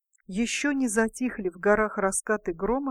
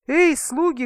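loud, high royal bark at the servants; barking shout; 6 semitones higher, 6 dB louder than usual. A narrator's reading. King shouting orders at his servants, loud bark. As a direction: bellow for the servants like a greedy king. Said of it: "гаркнул король".

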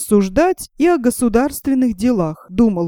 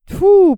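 high, loud disgusted spit; spitting tfu; 10 semitones higher, 4 dB louder than usual. A narrator's reading. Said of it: "плюнули братья".

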